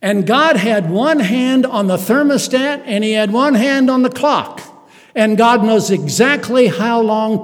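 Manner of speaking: loud, high preaching build; full-force sermon climax; fiery preaching. Acted. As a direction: preach it with rising fire.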